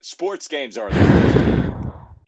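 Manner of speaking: loud, slow, emphatic disgust; drawn-out gripe. Real, not acted. Griping to friends about sports games, loud and slow. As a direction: drag it out loud like you're fed up.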